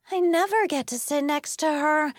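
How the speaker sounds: high, petulant whine; sulky, high-pitched protest; whiny complaint. A narrator's reading. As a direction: complain that it's unfair. Kids squabbling over seats beside Becca.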